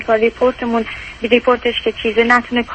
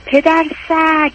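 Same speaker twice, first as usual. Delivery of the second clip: slow, higher mock yell of the insult; retold insult shout; 5 semitones higher, much slower than usual. Caller quoting her husband's insult in the mall, mild mock yell. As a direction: imitate the husband's insult, drawn out. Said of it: "داد زد".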